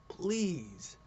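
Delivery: high, drawn-out "please" that falls away; earnest pleading. Real, not acted. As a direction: beg with one long, high please. Begging the publisher for a book.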